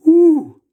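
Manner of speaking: sudden sharp high ooh from the fumes; startled high ooh. Real, not acted. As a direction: yelp as the sharp smell hits. Stung by horseradish fumes.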